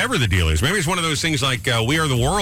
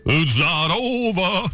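[name] impression; 4 semitones higher, much slower than usual. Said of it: "you sound like that [name] kid".

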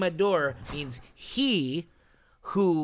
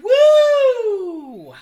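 a high, loud whoop; excited whoop; 9 dB louder than usual. Real, not acted. Cheering the first shot of the night, high loud whoop.

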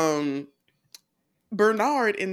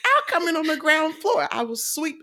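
high-pitched, eager mock plea; hammed-up eagerness, pitched well up; eager pleading; 9 semitones higher, faster than usual. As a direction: play the keen kid volunteering for any job. Acting out younger self begging for job.

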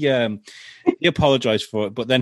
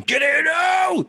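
high, drawn-out yell at a runaway dog; imitated exasperated owner's shout; mock angry shout; much slower than usual. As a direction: bellow a command as if calling the dog in.

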